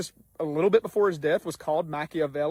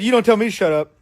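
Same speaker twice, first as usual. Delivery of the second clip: louder, higher mock-belligerent retort; 4 semitones higher, 8 dB louder than usual. Drunk salesman warning the speaker to back off, mock belligerent. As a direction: retell a drunk man's belligerent comeback. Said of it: "he's just belligerent".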